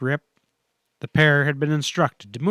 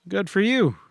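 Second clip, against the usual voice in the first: ordinary delivery, nothing marked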